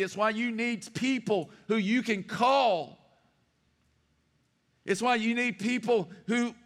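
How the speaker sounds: fervent pulpit preaching, rising and loud; full-force preaching. Acted. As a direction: preach it out to the whole room.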